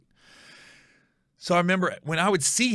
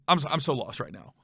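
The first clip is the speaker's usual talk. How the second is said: ordinary talk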